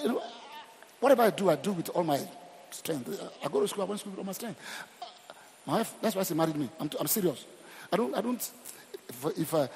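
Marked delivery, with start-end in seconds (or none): none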